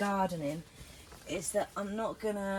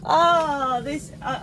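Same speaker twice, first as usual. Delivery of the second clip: excited; 5 semitones higher, 12 dB louder, much slower than usual. Real, not acted. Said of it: "this is making my day".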